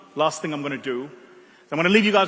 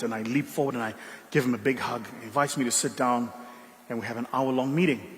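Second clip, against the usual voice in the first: ordinary delivery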